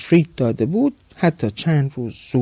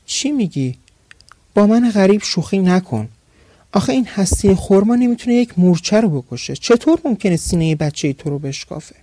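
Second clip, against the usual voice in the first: higher, louder merchant brushing it off with a smile; amused disbelief; 6 semitones higher, 4 dB louder than usual. A narrator's reading. Merchant thinks the demon is joking, smiling disbelief. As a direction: laugh off the accusation, half-smiling. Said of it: "لبخندی زد و گفت".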